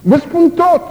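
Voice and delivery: high, loud pleading cry; imitated scream. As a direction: beg like a tortured man. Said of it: "țipau unii". (imitând un strigăt de groază)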